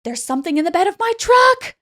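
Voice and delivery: high, loud panicked yell rising to a shriek; retold scream. A narrator's reading. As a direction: yell it in panic, climbing to the end. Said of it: "he screams out again".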